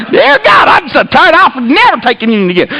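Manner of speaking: high outburst, easing down; fed-up vow. Retold or imitated. Tired man vowing to God, fed up. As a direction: mock-desperate complaint to heaven. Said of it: "and I'm mad".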